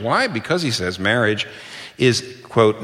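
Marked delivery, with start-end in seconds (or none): none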